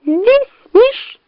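very high little squeak; tiny high-pitched imitation fox voice; squeaky fake fox. A narrator's reading. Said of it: "подладившись под голос лисы, пропищал".

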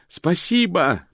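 high, slow shout over roaring flames; прокричал. A narrator's reading. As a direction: yell it over the fire. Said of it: "прокричал Мокрец сквозь рёв". (выкрик сквозь рёв пламени)

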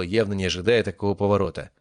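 ordinary delivery, nothing marked

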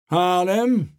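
loud, high bark of a name; thundering king. Acted. King thunders at Harlem to speak. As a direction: thunder the name across the table. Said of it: "donnerte der König".

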